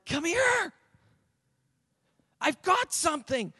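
put-on high, pressing call to a child; urgent parent calling; insistent parent. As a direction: summon a dawdling child, pitch way up. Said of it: "told your child to come".

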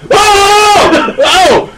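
put-on high panicked drunk uncle yelling. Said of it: "he doesn't sound like a drunk uncle".

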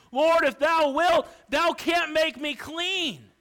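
loud, high, slow desperate plea; begging leper. Acted. Leper on his knees begging Jesus, loud and high. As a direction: cry out to the Lord from your knees.

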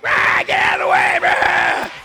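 loud rough shouting drivers, gruff and raspy; impatient honking-style roar; angry yelling. Retold or imitated. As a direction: bark at them to clear the road. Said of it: "were pulling up like".

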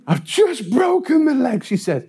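high-pitched cry of protest; exasperated mock shout. Paperboy telling woman about his leg, shouting. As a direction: re-enact a pained, indignant yell.

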